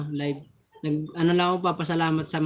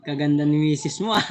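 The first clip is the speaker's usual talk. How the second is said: ordinary talk, nothing marked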